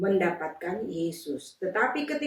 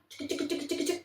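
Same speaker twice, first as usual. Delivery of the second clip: rowing sound effect; 8 semitones higher, 8 dB quieter than usual. Acted.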